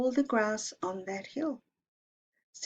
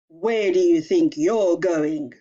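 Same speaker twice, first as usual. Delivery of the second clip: slow, menacing troll growl, louder; 2 semitones lower, 9 dB louder than usual. Acted. Troll menacing the little goat, slow and loud. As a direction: loom over the little goat, slow and threatening.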